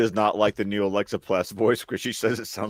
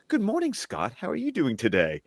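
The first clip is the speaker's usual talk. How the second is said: starts high and soft, a sweet assistant greeting; lightly voiced Alexa; 8 semitones higher, 5 dB quieter than usual. Alexa greeting its favourite owner, lightly mimicked nice voice. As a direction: lightly imitate a device being extra nice. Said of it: "Alexa device being nicer to me".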